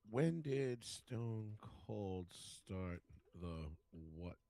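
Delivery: very slow, word-by-word muttering to self; absent-minded, halting search query; typing aloud. Real, not acted. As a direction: drag each word out as you type it. Reading out search while typing it.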